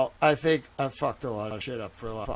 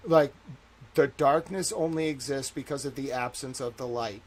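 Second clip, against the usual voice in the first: ordinary talk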